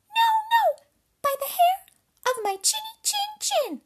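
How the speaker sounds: very high, loud squeaky piglet; defiant little pig. A narrator's reading. Pig refuses the wolf entry, high loud squeal. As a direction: squeal the refusal at the top of your range. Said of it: "The third little pig".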